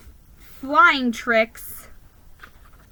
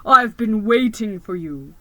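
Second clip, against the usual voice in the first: booming voice; 6 dB louder than usual. A narrator's reading. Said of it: "A booming voice rang out".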